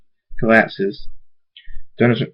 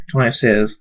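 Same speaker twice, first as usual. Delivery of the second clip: ordinary delivery, nothing marked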